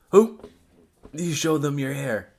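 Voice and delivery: normal talking voice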